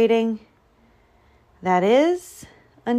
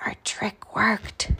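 slow, gleeful stage whisper; hushed secret gloating after a gasp; conspiratorial whisper; 4 dB quieter, slower than usual. A narrator's reading. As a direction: whisper it to fellow plotters. Animals gloat secretly in hiding.

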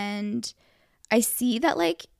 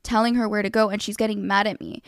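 usual speaking voice

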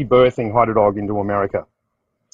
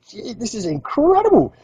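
high, wide-eyed "gee"; pitched-up mock astonishment; amazed thought; 10 semitones higher than usual. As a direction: marvel at your luck. His amazed inner thought.

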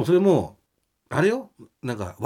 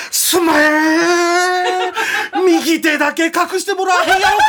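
loud, high, laughing Osaka pleading; Kansai manager voice; 5 dB louder, slower than usual. Retold or imitated. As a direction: beg loudly in Kansai dialect. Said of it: "それを見た王さんがさ".

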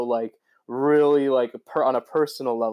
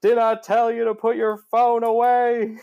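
put-on southern accent; 10 semitones higher, 4 dB louder than usual. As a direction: yell it like an angry Southern dad. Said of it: "he's got his southern, heavy southern accent in it".

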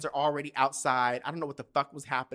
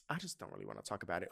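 low, soft, hurried brush-off; reluctant reply; 5 semitones lower, 11 dB quieter, much faster than usual. Retold or imitated. Child avoiding a talk with mom, reluctant and quiet. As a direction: imitate a kid quietly dodging the question, fast.

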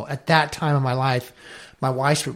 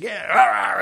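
imitating Hobie's growl; 7 semitones higher than usual. Mimicking his diving mentor Hobie for the host, hammed-up yelling growl. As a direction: bark out a wild diver's war cry, hammed-up. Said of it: "He's like".

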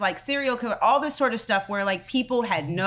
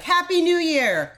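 higher, lightly mocking holiday cheer; mock cheery greeting; 8 semitones higher, 3 dB louder than usual. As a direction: chirp it as an imagined tweet. Imagined cheery old tweet.

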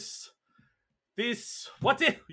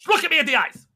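high, loud order; commanding shout; 8 semitones higher, 5 dB louder than usual. Acted. El Supremo ordering Ezra to meet his eyes, loud command. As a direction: demand he look at you. Tyrant demands eye contact.

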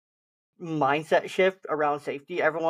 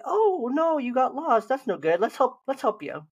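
put-on dad soothing a lost child, higher and gentle; calm and gentle; 7 semitones higher than usual. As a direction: lift the voice gently for a scared little kid. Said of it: "Stay calm. Be like".

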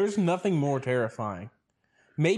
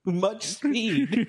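mimicking voice; 9 semitones higher than usual. Cohost mimics the boater line.

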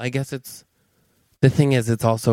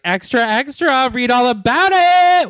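much higher, louder hawker's call; loud newsboy cry; 13 semitones higher, 5 dB louder than usual. Retold or imitated. Best friend Lewis bursts in with a newsboy shout. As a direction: belt it like a street newsboy. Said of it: "always is like".

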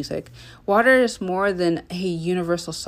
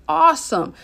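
excited; 9 semitones higher, 6 dB louder than usual. Real, not acted. Cheering on night owls.

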